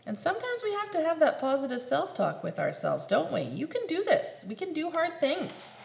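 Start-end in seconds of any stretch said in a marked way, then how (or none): none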